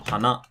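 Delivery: hana rising to na with the drop after it; hana with the fall after the last syllable; pitch drop after second syllable. Shown as a demonstration. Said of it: "Or maybe it drops after the second syllable".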